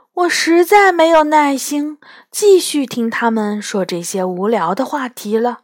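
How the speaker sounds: plain reading voice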